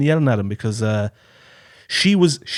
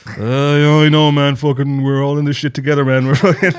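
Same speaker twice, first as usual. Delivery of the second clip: louder, lightly voiced buddy consoling; mock commiserating; 3 semitones higher, 7 dB louder than usual. Consoling a fellow sufferer, mild mock buddy voice. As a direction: pat a stranger's back in words. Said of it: "I try to be like".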